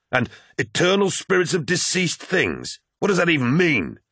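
high-pitched, exasperated question; incredulous. Acted. Loken questions talk of eternal spirits, incredulous. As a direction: scoff with pitch jumping up.

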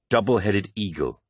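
ordinary delivery

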